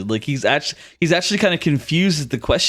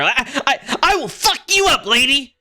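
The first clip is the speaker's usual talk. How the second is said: much higher, squeaky thug voice; squeaky little bandit; 10 semitones higher than usual. Acted. Four-foot bandit calls after the lady, squeaky high. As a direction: yap it out like a tiny mugger. Tiny bandit threatens with dagger.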